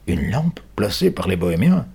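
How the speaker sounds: repeating it in disbelief, rising on bohémiens; the policeman repeats the boy's words, surprised. Acted. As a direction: echo the words, taken aback. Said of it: "répète l'homme, surpris".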